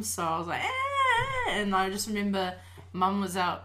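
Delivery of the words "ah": high, piercing mock child's siren wail; imitated wailing scream. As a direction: let out a kid's siren cry. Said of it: "I always had a siren scream".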